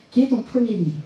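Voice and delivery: usual speaking voice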